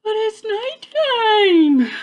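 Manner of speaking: high, slow little bat squeak. A narrator's reading. Young bat squeaking to Mother Bat, high and slow. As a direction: squeak it out like a scared baby bat. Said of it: "Stellaluna squeaked".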